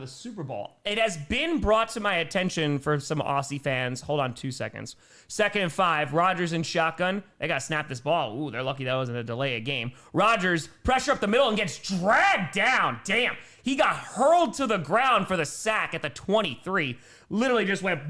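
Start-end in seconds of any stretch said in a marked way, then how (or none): 5.3-17.0 loud, punchy call building to the sack; live play-by-play. Real, not acted. Streamer calling a sack on Rodgers for viewers, excited play-by-play. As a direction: call the snap, then burst on the hit.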